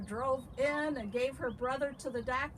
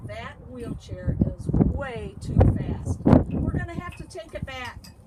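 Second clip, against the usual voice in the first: stern father; 11 dB louder than usual. A narrator's reading. Father tells the family it goes back, loudly stern. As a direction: lay it down loudly, slowing on "way too fast". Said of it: "Loretta's father said".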